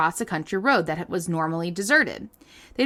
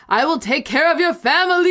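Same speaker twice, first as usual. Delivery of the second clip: booming voice; 6 semitones higher, 5 dB louder than usual. Retold or imitated. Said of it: "a booming voice echoed through the whole church".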